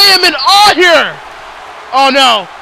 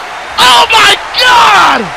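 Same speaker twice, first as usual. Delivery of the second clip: commentator's shout; 4 semitones higher than usual. Real, not acted. Commentator calls Rave of Destiny through the table, shouting. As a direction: scream it as the table breaks. Table smash in wrestling match.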